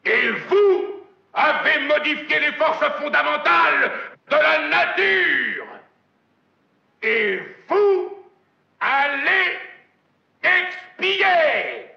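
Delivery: hammy stage actor. Acted. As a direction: bellow the sentence like a wrathful god. Thunderous climax of the rant.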